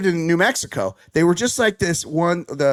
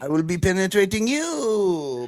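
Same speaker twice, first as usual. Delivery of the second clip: mock French guy, over the top; hammed-up seductive French accent; 3 semitones higher, slower than usual.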